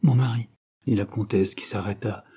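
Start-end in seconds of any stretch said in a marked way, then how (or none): none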